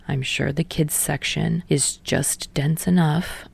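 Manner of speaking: low, soft, slow deadpan jab; dry jab. Acted. Tsukishima mocking Hinata's reading level, low and deadpan. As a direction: deliver the joke deadpan.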